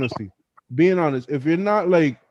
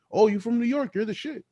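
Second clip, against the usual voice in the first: much higher, gushing "Oh, you from New York?"; starstruck; 8 semitones higher than usual. Retold or imitated. Foreigner thrilled to meet a New Yorker, high and gushing. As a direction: act dazzled by a New Yorker.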